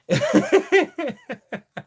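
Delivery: real laughter at own joke; high loud laugh. Real, not acted.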